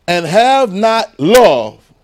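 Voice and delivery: and have not love drawn out very slowly, loud and high; preaching at full force. Acted. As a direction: land the line with full weight. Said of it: "preaching the key line".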